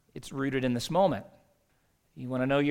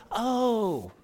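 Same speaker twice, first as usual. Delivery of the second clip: high, drawn-out "oh"; mock sudden realization; 7 semitones higher than usual. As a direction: act as if it suddenly all makes sense. Mock sudden understanding by foreigner.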